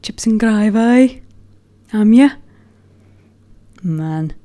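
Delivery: slow, exaggerated Brummie accent mocking his younger self. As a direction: drawl it out in a thick Birmingham accent. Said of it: "this very ridiculous Brummie accent".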